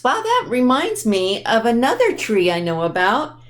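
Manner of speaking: ordinary delivery, nothing marked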